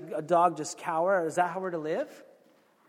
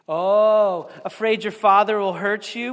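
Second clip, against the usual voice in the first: loud sarcastic taunt; 3 semitones higher, 9 dB louder than usual. Retold or imitated. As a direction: taunt her with a sneer. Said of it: "asked sarcastically".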